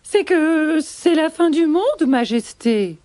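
high-pitched gazelle addressing royalty; hesitant gazelle. Acted. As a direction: stall a little, high-voiced, before the king. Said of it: "répond une gazelle".

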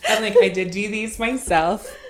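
high and loud through laughter; laughing proudly. Real, not acted. Guest tells the host she did her own nails, laughing loudly. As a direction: laugh while boasting you did them.

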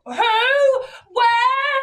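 very high, loud shriek; drunken yelling. Retold or imitated. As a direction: yell out like an excited drunk. Drunk friend hunting for celebrity.

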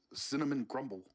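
usual speaking voice

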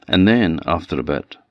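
usual speaking voice

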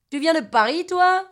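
put-on teasing question in a Marseille accent; imitated Marseille accent. Voices the man from Marseille.

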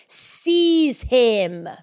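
long, drawn-out royal order; dramatic command. Acted. King orders his men to seize a man, commanding. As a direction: stretch it out, a king ordering an arrest. Reads king's order from scripture.

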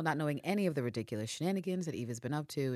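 ordinary delivery

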